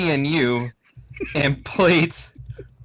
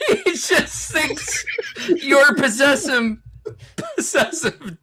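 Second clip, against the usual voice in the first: laughing; 8 semitones higher than usual. Real, not acted. Still laughing at the poll.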